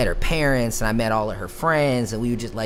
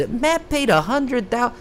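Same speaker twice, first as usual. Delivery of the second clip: much higher, slow, put-on voice; mocking repeat; 11 semitones higher, slower than usual. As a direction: parrot the rumor back in a silly mocking voice.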